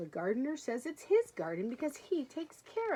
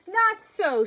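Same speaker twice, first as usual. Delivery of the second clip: sniffy woodchuck; 9 semitones higher, 10 dB louder than usual. Acted. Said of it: "sniffed the woodchuck".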